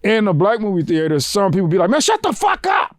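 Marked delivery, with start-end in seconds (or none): 1.9-2.9 high-pitched mock outburst; fed-up moviegoer. Moviegoer silencing talkers, mock angry. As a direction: act out an exasperated snap, pitched way up.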